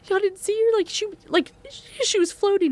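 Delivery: high, stammering disbelief; frightened child. Retold or imitated. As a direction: act out a scared kid insisting. Frightened child insists, acted out.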